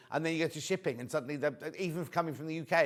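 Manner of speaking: normal talking voice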